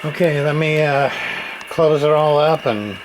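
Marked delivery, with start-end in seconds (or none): none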